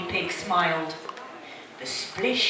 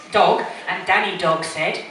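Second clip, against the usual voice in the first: usual speaking voice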